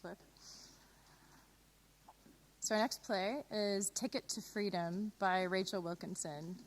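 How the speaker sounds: normal talking voice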